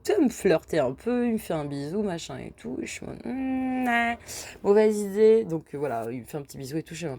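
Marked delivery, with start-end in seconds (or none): 3.2-5.4 teasing hesitation. Retold or imitated. Ex kisses her, hesitant.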